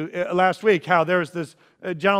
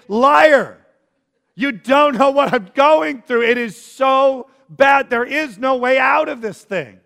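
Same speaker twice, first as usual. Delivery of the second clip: loud, higher, hurt disbelief; bitter protest; 5 semitones higher, 8 dB louder than usual. Retold or imitated. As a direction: throw it back at the pastor, wounded. Congregant's inner reply to pastor.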